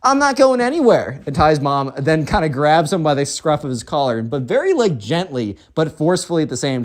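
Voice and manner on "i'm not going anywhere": high, whining refusal; defiant spoiled kid. Acted. Spoiled kid resists the mom pushing him out, defiant. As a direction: whine it high and dig in.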